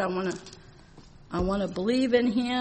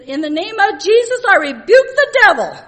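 loud, high commanding shout; forceful rebuke; 8 semitones higher, 11 dB louder than usual. Retold or imitated. Minister in his room shouting a rebuke at the devil. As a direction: cast the devil out with full force.

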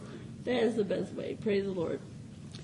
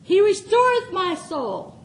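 scripture reading; 5 semitones higher, 7 dB louder than usual. Acted. Reads Psalm twenty-three aloud.

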